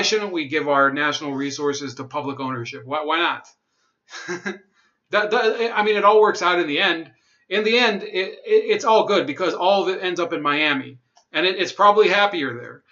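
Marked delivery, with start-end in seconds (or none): 5.1-12.8 sarcastic. Real, not acted. Sarcastic aside on money ending in Miami.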